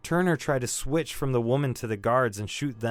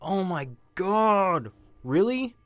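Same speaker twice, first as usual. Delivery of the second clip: exasperated disbelief; 6 semitones higher, slower than usual. Acted. Exasperated at restarting interrogation.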